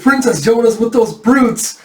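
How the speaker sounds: high, loud alarm; hammed-up horror at a dreadful thought; horrified outcry. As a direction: cry out in melodramatic dismay. Horrified at what he imagines.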